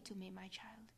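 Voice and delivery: soft unvoiced whisper of my child; an intimate whispered my child. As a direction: breathe it tenderly to the child. Mother's intimate plea to child.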